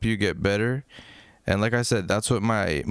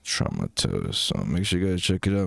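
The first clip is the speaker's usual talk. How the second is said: much lower, subdued voice; really quiet; 7 semitones lower than usual. Shown as a demonstration. Streamer showing chat how he goes low on long lives. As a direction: drop way down to save the voice. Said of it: "I'll be like this".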